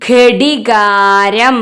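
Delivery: drawn-out model reading of ഘടികാരം; reading demonstration. Shown as a demonstration. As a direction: sound out ഘടികാരം loudly, syllable by syllable. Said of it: "ഒന്നും കൂടി വായിച്ചേ".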